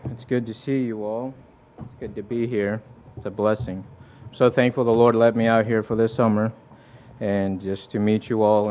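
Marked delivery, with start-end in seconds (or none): none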